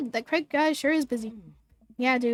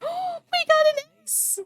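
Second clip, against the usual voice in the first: much higher, slow, delighted; thrilled squeal; 13 semitones higher, slower than usual. Real, not acted. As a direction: stretch it out in pure glee. Celebrating top rank.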